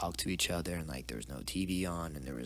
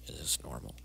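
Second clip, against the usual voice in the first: hushed, unvoiced, lower; whispered thought; 6 semitones lower, 8 dB quieter than usual. Sent from the table, wondering in a whisper. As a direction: mutter it under your breath.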